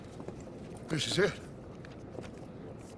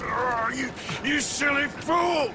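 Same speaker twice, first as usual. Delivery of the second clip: exasperated shout; 7 semitones higher than usual. Acted. Scolding a rescued friend.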